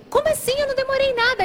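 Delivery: high-pitched panicked protest; retold panic. Girlfriend told he has left, panicked protest. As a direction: act out sudden panic, pitch leaping up. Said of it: "me deu um pânico".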